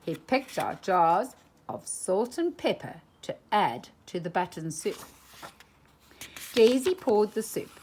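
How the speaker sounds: plain reading voice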